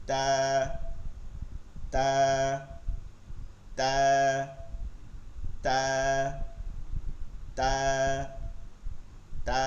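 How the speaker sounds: তা with tongue tip at the gum behind the upper teeth; Arabic letter ta demonstrated; drilled letter sound. Shown as a demonstration. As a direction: model তা crisply for students. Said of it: "লেগে উচ্চারণ হবে".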